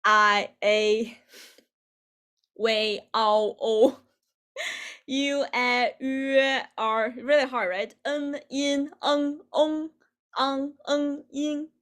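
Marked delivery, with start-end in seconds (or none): 0.0-7.1 pinyin finals sounded out one at a time; teacher reciting a vowel chart, item by item; vowel demo. Shown as a demonstration. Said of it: "these different vowels". 8.0-11.7 pinyin nasal endings sounded out one by one; higher, evenly held nasal finals; nasal finals demo. Shown as a demonstration. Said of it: "these different vowels".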